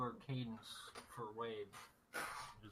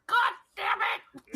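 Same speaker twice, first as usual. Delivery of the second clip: shouted curse; 11 dB louder than usual. Acted. Mock outburst in a retold quote.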